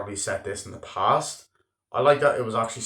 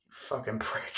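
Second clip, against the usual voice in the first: muttered insult; 8 dB quieter, slower than usual. Retold or imitated. Dad muttering insult after.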